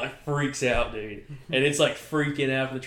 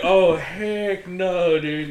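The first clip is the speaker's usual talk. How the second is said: loud, drawn-out, higher refusal; retold horrified refusal; 5 semitones higher, 10 dB louder, much slower than usual. Boy facing a crawling raccoon, retold horrified refusal. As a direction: draw it out loudly in mock horror.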